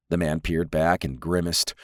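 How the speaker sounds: ordinary delivery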